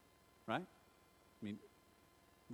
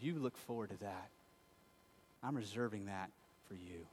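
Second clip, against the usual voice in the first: tender promise; 6 semitones lower, 9 dB quieter than usual. Acted. Jesus' promise voiced softly.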